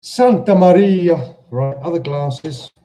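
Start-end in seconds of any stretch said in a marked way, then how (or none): 0.0-1.4 a loud exasperated "Santa Maria"; loud exclamation. Real, not acted. Exasperated exclamation, much louder.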